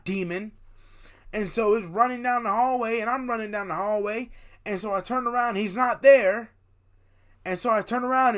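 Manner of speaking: loud, high, worked-up storytelling; excitable dream recap at high volume; animated storytelling. Acted. Retells the dream chase.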